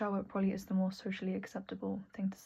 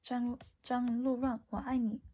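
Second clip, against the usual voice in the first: put-on slow, high Chinese accent; 4 semitones higher, slower than usual. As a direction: lovestruck line in a Chinese accent. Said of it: "How's my Chinese accent?".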